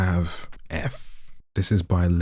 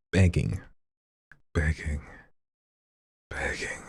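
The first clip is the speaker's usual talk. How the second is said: building drama for the chat by fading the word; fading repeats. Shown as a demonstration. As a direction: let each begging fade out. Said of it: "You see what I'm doing?".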